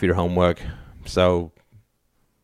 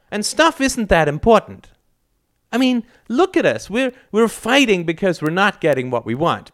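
high, pleading outburst; young girl's earnest protest; impassioned; 12 semitones higher than usual. A narrator's reading. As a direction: plead fervently, pitch way up. Daughter's impassioned speech to family.

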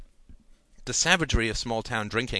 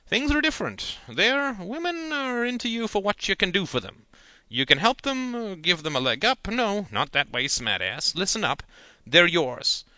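higher, louder uncle warning, no real whisper; lecturing uncle; 9 semitones higher, 5 dB louder than usual. A narrator's reading. Uncle warns nephew about city women, mock-ominous. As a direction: warn the boy darkly. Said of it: "whispering as if down there".

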